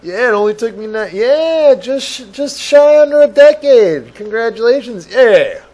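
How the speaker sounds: loud, high-pitched movie-quote outburst; hammed-up excitement. Acting out film dialogue.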